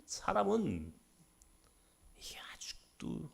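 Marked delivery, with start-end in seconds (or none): 2.2-3.3 hushed, fading whisper. Acted. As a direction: whisper the rebuke. Whispered rebuke in sermon.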